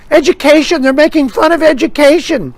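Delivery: high-pitched scandalised complainer; hammed-up offended protest; mock outrage. Objector shocked at fun made of education, mock outrage.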